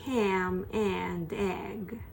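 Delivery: very slow "Ham and egg"; slow drill. Shown as a demonstration. Teacher drilling the phrase word by word. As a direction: stretch every word.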